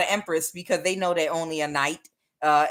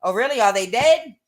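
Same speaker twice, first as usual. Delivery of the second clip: higher, louder mock nonchalance; playing it off; 6 semitones higher, 6 dB louder than usual. Somebody covering an ego death, playing it off. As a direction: play it off as if it's handled. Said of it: "somebody's trying to play it off like".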